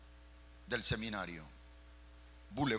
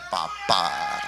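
shouted, delighted "papá"; loud exclamation; 5 semitones lower, 11 dB louder than usual. Real, not acted. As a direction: burst out with joy. Exclaiming at the car news.

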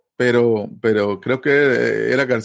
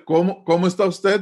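asking politely; 6 semitones higher than usual. Shown as a demonstration. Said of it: "Para preguntarle".

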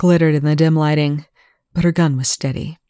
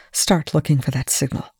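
ordinary delivery

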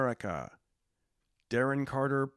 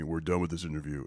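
dropping voice deep; 8 semitones lower, faster than usual. Shown as a demonstration. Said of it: "This is me".

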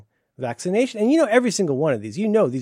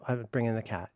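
ordinary talk, nothing marked

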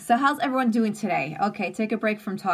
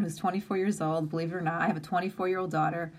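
usual speaking voice

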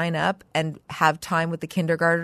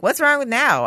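a bit louder, demanding "What's wrong with now?" pushed hard on "wrong"; mock exasperated parent; impatient demand; 6 semitones higher, 4 dB louder than usual. Shown as a demonstration. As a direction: snap the question at her, irritated. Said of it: "I wasn't being like".

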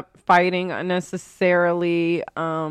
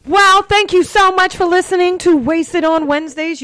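loud, high show-host voice; announcer sign-off; 11 semitones higher, 7 dB louder than usual. Acted. Host thanking listeners at show's end, loud announcer sign-off. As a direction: project a big closing thank-you.

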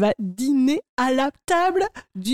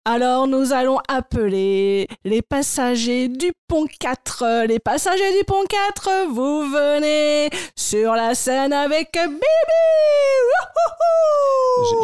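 cruise entertainer; 3 semitones higher, slower than usual. Acted. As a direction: whip up the crowd like a cruise animator. Cruise animator hosting games.

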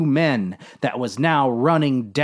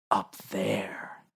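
hushed, whispered warning; ominous whisper; 6 semitones lower, 11 dB quieter than usual. Acted. Dave warning the captain about the surface, ominous whisper. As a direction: drop right down to a wary whisper. Dave warns of danger above.

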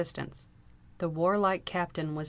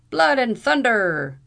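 much louder, high, slow roar; 6 semitones higher, 11 dB louder, slower than usual. A narrator's reading. Captain roars as he is arrested. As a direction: roar the oath in fury. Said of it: "roared Captain O'Blunderbuss".

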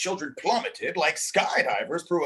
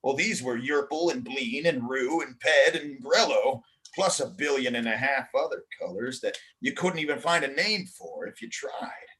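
ordinary delivery

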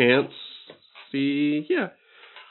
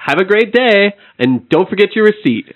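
cheerful shopkeeper voice; 7 semitones higher than usual. Acted. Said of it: "And she goes".

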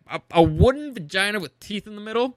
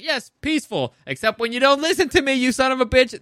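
mock hypocrite; 6 semitones higher, 3 dB louder than usual. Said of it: "It's like".

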